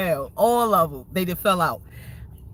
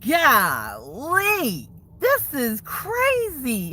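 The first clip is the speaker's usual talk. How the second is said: incredulous; 6 semitones higher, much slower than usual. Real, not acted. Incredulous exclamation.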